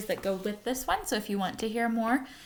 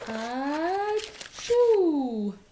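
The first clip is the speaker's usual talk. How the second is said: louder, higher burst as the bear sneezes; sneeze build-up; 7 semitones higher, 5 dB louder than usual. A narrator's reading. Reading the bear's big sneeze to the class, loud and high. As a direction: explode into the sneeze.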